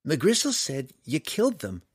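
put-on young woman passing on the accusation; Canterbury's voice. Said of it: "Canterbury continued".

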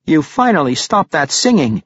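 cheeky little halfling teasing her. A narrator's reading. Halfling greets the singer.

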